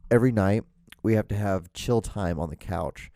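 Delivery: ordinary talk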